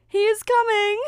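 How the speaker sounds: very high, loud excited call. A narrator's reading. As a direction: stepsister announcing him from the window. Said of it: "called one of the stepsisters".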